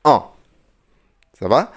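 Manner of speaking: normal talking voice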